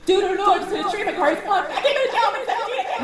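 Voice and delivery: high, fast panicked babble; mock-frantic pleading to a friend; panicky plea. Panicked drunk self quoted.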